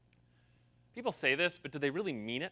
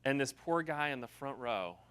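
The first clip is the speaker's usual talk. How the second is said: normal talking voice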